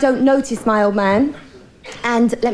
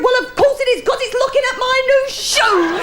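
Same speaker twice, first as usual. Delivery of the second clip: much higher, indignant husband with a laugh in it; 12 semitones higher than usual. Retold or imitated. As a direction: squeak out the husband's retort. Husband's punchline answer.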